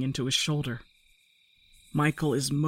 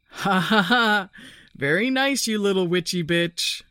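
loud, high forced laugh into a jeer; mock-applauding taunt, finding courage; croaked taunt; 5 semitones higher, 5 dB louder than usual. A narrator's reading. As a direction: jeer loudly to cover your fear. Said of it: "Michael croaks".

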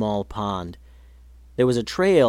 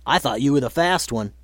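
teasing. A narrator's reading. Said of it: "teased Marty".

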